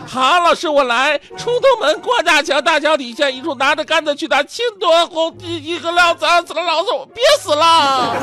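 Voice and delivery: put-on high, loud, straining kid voice; a kid gasping through the tongue twister; child out of breath.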